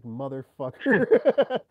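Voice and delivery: loud, slow, drawn-out curse; fed-up groan at the phone; exasperated curse. Retold or imitated. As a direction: drag it out, fed up. Exasperated at twenty cold calls.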